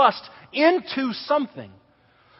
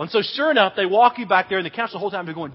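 usual speaking voice

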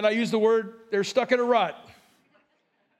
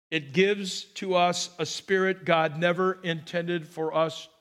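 usual speaking voice

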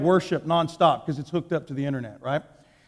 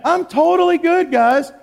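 higher, falsely breezy brush-off; fake cheerful; 9 semitones higher, 5 dB louder than usual. Preacher lying to the pastor on the phone, mock cheerful. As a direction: cover it up with a bright fake okay. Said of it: "I'm lied again".

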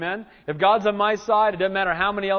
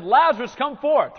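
high, loud call into the tomb; commanding call; 6 semitones higher, 6 dB louder than usual. Retold or imitated. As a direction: summon him out of the grave. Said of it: "Remember he said".

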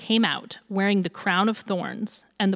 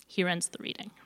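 ordinary delivery

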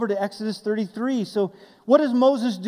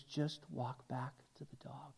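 much softer, gentle and steady; soft calm instruction; 8 semitones lower, 21 dB quieter than usual. Retold or imitated. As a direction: coax a scared child quietly. Rancher calmly instructing girl.

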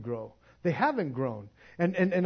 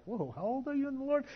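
amazed question; 9 semitones higher, 5 dB quieter, faster than usual. Pretend. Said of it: "And it's like".

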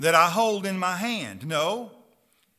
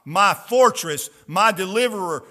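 louder, ringing scripture proclamation; preaching; 5 dB louder than usual. Acted. Preacher proclaiming psalms to the congregation, ringing out. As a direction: proclaim it to the whole hall.

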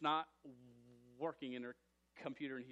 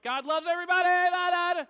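overzealous student; 13 semitones higher, 16 dB louder than usual. Retold or imitated. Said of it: "there's a time when you're like".

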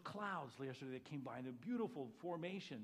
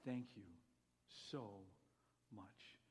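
much softer, intimate thanks; tender prayer; 7 semitones lower, 8 dB quieter, slower than usual. Shown as a demonstration. Quiet thanks to the Father standing near: tender, much softer. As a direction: murmur it to someone right beside you. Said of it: "I can say".

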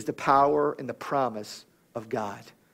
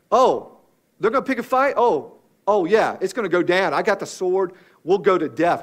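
louder, cocky mock Peter itching for a fight; brash big talker; 2 semitones higher, 5 dB louder than usual. Preacher acting out Peter spoiling for a fight, brash big talker. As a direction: talk big, raised and punchy, like a hothead. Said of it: "He was always saying something".

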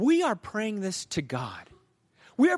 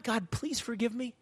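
higher, softer tentative prayer; timid plea; 5 semitones higher, 6 dB quieter than usual. Shown as a demonstration. Preacher showing how not to pray, timidly. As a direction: plead small and high, as a demo.

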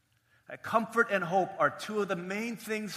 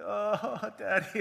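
higher-pitched wail, played for laughs; mock sobbing; 5 semitones higher, much slower than usual. Son begging dad to stay at school, mock sobbing. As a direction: imitate a weeping child, laughing through it. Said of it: "weeping and sobbing".

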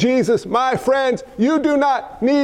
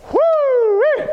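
excited whoop; 12 semitones higher than usual. Real, not acted. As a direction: whoop with delight. Preacher's excited exclamation.

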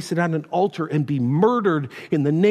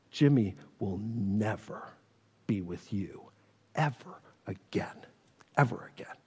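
stone-faced mother; 10 semitones lower, 12 dB quieter than usual. Retold or imitated. Jimmy's mother at the door refusing the boy, coldly whispered. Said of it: "she's just stone-faced".

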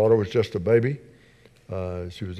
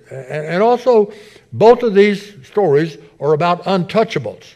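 raised, forceful sermon delivery; emphatic preaching; 5 semitones higher, 7 dB louder than usual. Real, not acted. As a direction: drive the point home to the congregation, pitched high.

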